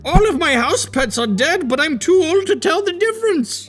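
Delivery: high, hammed-up decrepit elder voice; old people voice. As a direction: caricature of an ancient, doddering person. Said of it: "this is my old people voice".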